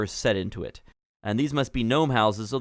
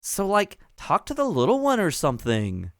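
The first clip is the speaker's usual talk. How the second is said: put-on valley girl gnome; 7 semitones higher than usual. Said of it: "And she says".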